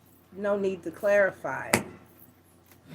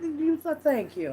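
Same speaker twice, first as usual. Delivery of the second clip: higher, light thank-you; acted polite reply; 7 semitones higher, 4 dB quieter than usual. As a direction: play a modest reply to flattery. Acts the reply to a compliment.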